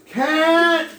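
loud high call for the cat; yelling. Retold or imitated. Foreigner calling his lost cat in the street, shouting. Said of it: "So I'm yelling".

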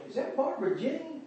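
very high, soft voice of wondering neighbours; hushed questioning. Acted. Neighbours seeing Mama back in the neighborhood, hushed questioning. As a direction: pitch it way up and keep it small.

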